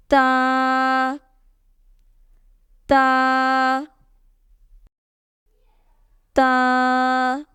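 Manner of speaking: "ta" with the vowel held a little long; slightly stretched ta. Shown as a demonstration. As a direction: draw out each "ta" slightly. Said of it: "read it with a slight stretch".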